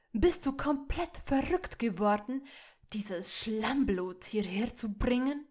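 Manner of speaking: loud, scolding shout; angry outburst. Acted. Father shouts at his son for bringing her home, furious. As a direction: roar it at your son in disbelief.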